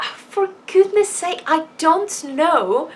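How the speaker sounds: exasperated self-quote. Retold or imitated. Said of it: "And I was like".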